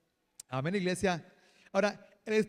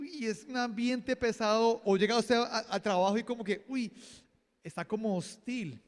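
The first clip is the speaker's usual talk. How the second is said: ordinary talk, nothing marked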